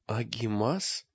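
soft, slow, faltering question; frightened babble. A narrator's reading. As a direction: barely get the word out in fear. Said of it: "пролепетал Олег". (тихо, испуганно лепеча)

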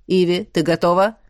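a calm commanding deity; deep god voice. A narrator's reading. God asks the sprite. (голос бога)

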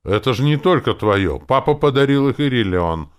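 loud, high-pitched protest; indignant. A narrator's reading. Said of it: "возмутился Стади".

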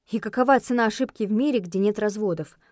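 plain reading voice

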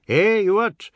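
usual speaking voice

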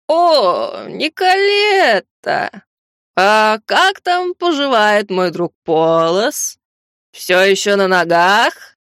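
slow, tipsy slurring voice; slurred drunk. Acted. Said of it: "слегка заплетающимся голосом". (пьяный заплетающийся голос)

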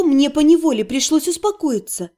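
plain reading voice

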